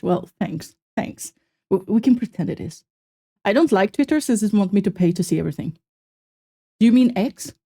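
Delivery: ordinary talk, nothing marked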